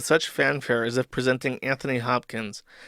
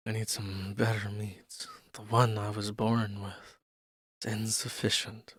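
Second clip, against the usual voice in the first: slow, very soft, low delivery; low put-on voice; 5 semitones lower, 12 dB quieter than usual. Streamer playing someone in need of better meats, low and slow. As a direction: lower the voice and draw it out quietly.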